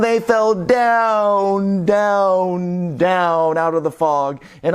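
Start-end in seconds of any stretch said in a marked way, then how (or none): none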